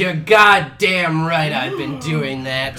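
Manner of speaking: loud, slow, drawn-out yell; slow shout. Acted. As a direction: bellow it slowly. Angry secretary blurts out confession.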